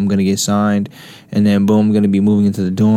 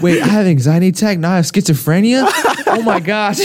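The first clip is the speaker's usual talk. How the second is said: high-pitched laughing fake alarm; giggly, high mock hypochondriac; mock panic while laughing; 7 semitones higher than usual. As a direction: play along with hammed-up dread, cracking up. Joins the mock self-diagnosis, laughing.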